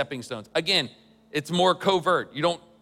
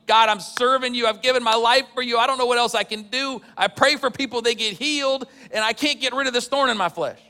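pleading prayer pitched higher and louder; Paul begging God; 7 semitones higher, 3 dB louder than usual. Retold or imitated. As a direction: beg God desperately as Paul, lifting the voice. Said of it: "he's begging God".

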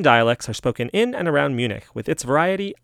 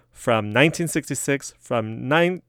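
ordinary delivery

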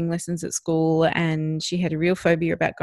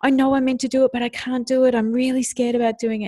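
higher, louder mock protest in a child's worried voice; panicky child protest; 6 semitones higher, 5 dB louder than usual. Scared daughter protesting to mother about the lessons, imitated panic. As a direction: imitate an anxious child refusing, pitched up. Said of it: "straight away she went into".

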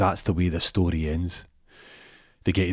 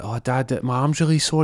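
put-on higher child voice; young boy; 7 semitones higher than usual. Benji tells Dad his arm is sore, high child voice. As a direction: complain like a small son.

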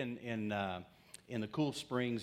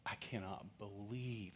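quiet exasperation; 9 dB quieter, slower than usual. Retold or imitated. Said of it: "and she's like".

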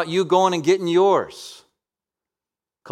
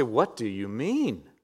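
imagined listener; 4 semitones lower, 5 dB quieter, slower than usual. Acted. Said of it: "You say".

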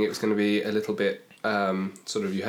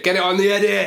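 loud; 9 semitones higher, 6 dB louder than usual. Real, not acted. Repeats the line as a cry.

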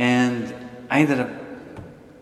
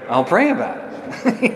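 higher, slow, sly pray about it; knowing brush-off; 10 semitones higher, slower than usual. Retold or imitated. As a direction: drag out "I'll pray about it" as a polite no. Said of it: "what do you think I said?".

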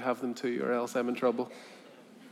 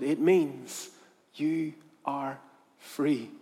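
slow, spaced-out words; measured declaration; much slower than usual. Acted. Buyer explains to the puzzled girl, slow and measured. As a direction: spell it out gently, one word at a time. Man repeats her freedom.

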